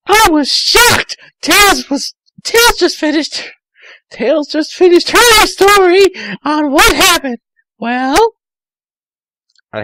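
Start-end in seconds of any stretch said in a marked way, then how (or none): 0.1-8.3 high shocked Amy. Acted. Amy narrating her shock, very high girly voice. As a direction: gasp it out as a girl.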